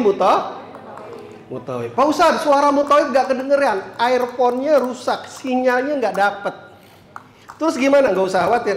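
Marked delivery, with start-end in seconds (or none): 1.9-6.5 higher, drawn-out complaint; complaining pilgrim. Acted. Pilgrim telling ustadz the earphone is broken, drawn-out complaint. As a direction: voice a pilgrim's problem, high and slowing.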